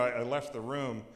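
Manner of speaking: ordinary delivery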